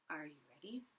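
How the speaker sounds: hushed secret whisper. Real, not acted. Teacher whispering to the children before the song, softly. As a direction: lean in and whisper it.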